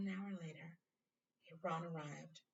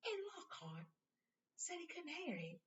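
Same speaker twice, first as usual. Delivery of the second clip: high, soft, puzzled Ron; hushed Ron; 12 semitones higher, 6 dB quieter than usual. Acted. Ron whispering to Harry at night, puzzled. Ron voiced, low voices.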